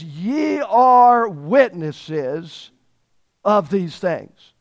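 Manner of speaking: stretched out, are lifted; slow solemn commission. Acted. Jesus telling disciples their calling, slow and solemn. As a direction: lay it on them slowly.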